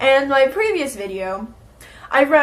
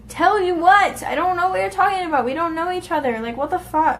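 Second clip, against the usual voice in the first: exasperated; 6 semitones higher than usual. Real, not acted. Exasperated reply to creepy message.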